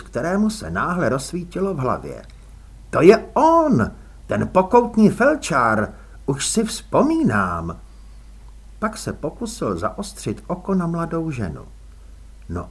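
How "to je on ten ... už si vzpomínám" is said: excited recognition. A narrator's reading. Sudden realization after the exclamation.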